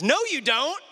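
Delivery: put-on high, bratty child voice; mock a toddler sister teasing; sing-song childish denial; teasing little girl. Sister teasing in retold story.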